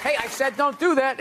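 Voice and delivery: exasperated grown-up warning; mock scolding yell. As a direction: scold a stranger's child, half yelling. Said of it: "you could yell at people's kids".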